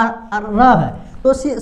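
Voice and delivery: ordinary talk, nothing marked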